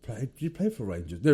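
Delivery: ordinary talk, nothing marked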